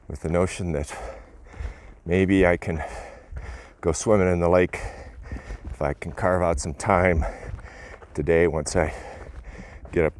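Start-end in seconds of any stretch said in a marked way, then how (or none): none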